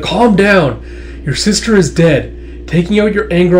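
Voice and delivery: loud, high plea for restraint; acted urgent intervention. Tuya grabbing the warlord's arm, urgent.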